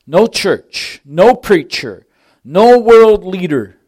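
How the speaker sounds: loud and slow, each item hammered; emphatic preaching. Acted. Preacher warning the congregation, loud and slow. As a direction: pound out each no.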